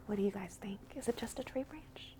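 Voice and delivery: whispered aside to the listeners. A narrator's reading. Storyteller asking listening children, in a whisper. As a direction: whisper it like a secret to the kids.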